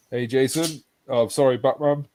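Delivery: normal talking voice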